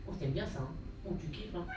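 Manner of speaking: very soft cooing; cooing to cat. Real, not acted. Petting his cat, soft tender cooing. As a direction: murmur gently to the cat.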